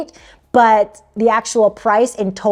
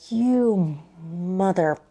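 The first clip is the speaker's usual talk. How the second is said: bitten-off curse; 2 semitones lower, 6 dB quieter, much slower than usual. Real, not acted. Traveler cursing at the price jump, in a slow held-back mutter. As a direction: swallow the insult halfway.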